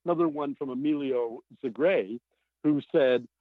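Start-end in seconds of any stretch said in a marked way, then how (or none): none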